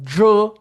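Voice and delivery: jo loud, nasal vowel, curled tongue; model syllable. Shown as a demonstration. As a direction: belt out the model jo. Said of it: "minha língua ... encurvada". (jo alto e claro como modelo)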